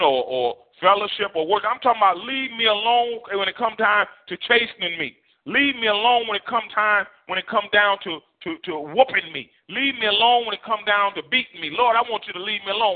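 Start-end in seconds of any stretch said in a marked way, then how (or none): 1.6-13.0 higher, driving repeated pleas; rising preacher refrain; preaching build. Real, not acted. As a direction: push each repeat like a sermon peak.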